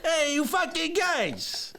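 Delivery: high, brash New York tough guy; Joe Pesci impression. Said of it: "Joe Pesci in The Goonies".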